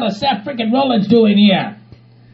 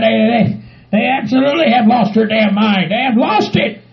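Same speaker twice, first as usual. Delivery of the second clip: normal talking voice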